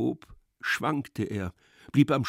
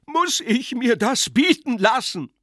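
high, loud indignant cry; outraged shout; 12 semitones higher, 8 dB louder than usual. Acted. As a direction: shout out the insult you suffer. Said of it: "schrie Iwan Iljitsch". (empört geschrien)

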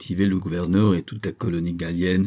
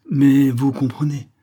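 wheedling, higher and louder lead-in; unctuous; 5 semitones higher, 5 dB louder than usual. A narrator's reading. Merchant coaxes the governor, unctuous. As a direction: sweet-talk him with a lifted "mais". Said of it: "reprit doucereusement Isaac".

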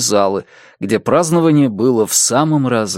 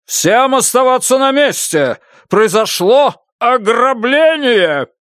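roaring; 12 semitones higher than usual. Acted. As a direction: roar the announcement over the crowd. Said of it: "проревел он".

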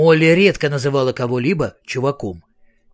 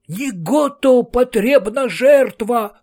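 much higher, slow booming cry; cried out; 8 semitones higher, 3 dB louder, much slower than usual. A narrator's reading. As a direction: proclaim the demand for sacrifice. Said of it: "вскричал закадровый голос".

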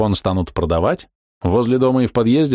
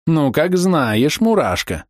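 slow drawn-out sinister purr; slow theatrical villain menace; 6 semitones higher, much slower than usual. A narrator's reading. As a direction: drawl it out like a villain. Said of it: "зловеще протянула она".

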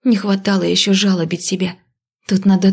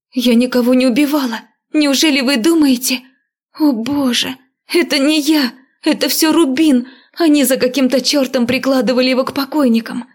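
rapid panicked babble; 6 semitones higher than usual. Acted. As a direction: gabble it out in panic. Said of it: "затараторила я".

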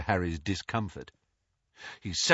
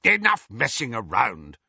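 snarled; 4 dB louder than usual. A narrator's reading. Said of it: "snarled Moody".

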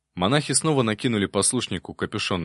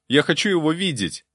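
higher, louder-edged insistence; insistent demand; 8 semitones higher, 4 dB louder than usual. Acted. Novice demanding of the abbot to see his brother, insistent. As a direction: demand it, pitched up.

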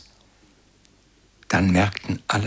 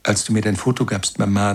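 plain reading voice

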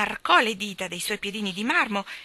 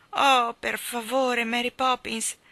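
a slow, tender plea; sweet murmur; 5 semitones higher, 5 dB louder, slower than usual. A narrator's reading. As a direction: coax her gently, almost melting. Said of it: "egli mormorò con dolcezza".

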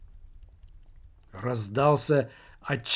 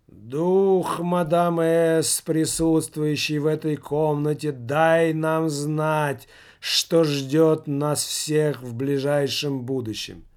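slow, monotone seance incantation; mournful distant summoning; droning, detached; 3 semitones higher, 4 dB louder, slower than usual. Acted. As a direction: chant it from far away, very slowly. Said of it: "Заунывно, монотонно и как-то очень отстранённо".

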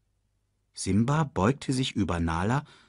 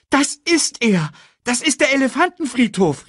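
high, loud, excited cry; thrilled exclamation; 5 dB louder than usual. A narrator's reading. Simba finds the elephant graveyard, thrilled. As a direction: call out your great discovery. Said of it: "Simba war begeistert".

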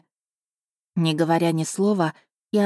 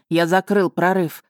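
voice breaking. A narrator's reading. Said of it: "и его голос надломился".

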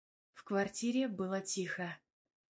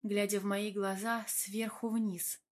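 ordinary delivery

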